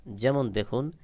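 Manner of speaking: usual speaking voice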